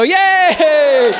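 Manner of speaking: loud, high, long cheer; hammed-up victory whoop; mock triumph. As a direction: shout a drawn-out yay. Cheers his own rapping title.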